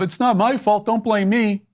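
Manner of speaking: high, whiny mocking of a defensive excuse. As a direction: sneer it like a sulky kid dodging blame. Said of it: "a defensive posture of".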